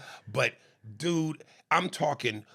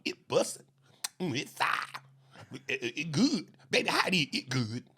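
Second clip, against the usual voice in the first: lightly mocked Southern woman relishing food; imitating food vlogger; 2 semitones higher than usual. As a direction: mimic her savouring each short phrase. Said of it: "when she talks, she goes".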